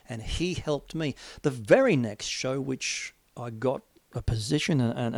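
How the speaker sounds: normal talking voice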